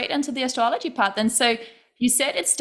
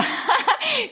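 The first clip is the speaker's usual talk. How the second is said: high giggle; 13 semitones higher than usual. Real, not acted. Laughing at her own stumble.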